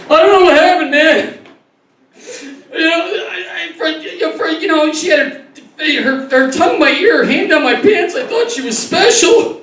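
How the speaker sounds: high, hammed-up wounded man; mock victim. Comic voices a man claiming he was seduced, high mock-wounded whine. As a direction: whine it like a used innocent.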